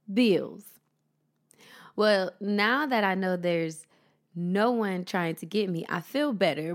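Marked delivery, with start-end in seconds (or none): 0.1-0.6 emphatic. Real, not acted. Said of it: "final emphatic bills".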